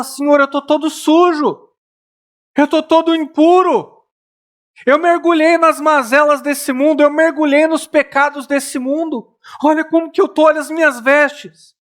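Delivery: pleading sinner. Acted. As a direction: cry out your shame to the Lord. Sinner voiced pleading to Jesus.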